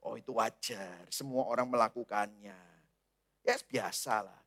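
low, soft coaxing voice; soothing tempter. Acted. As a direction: murmur it like the devil excusing sin.

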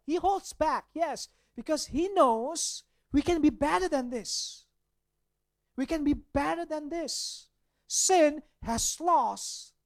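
loud, high preacher's climb; fervent sermon peak; preaching at full force. Acted. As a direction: proclaim it to the congregation. Preaching builds up.

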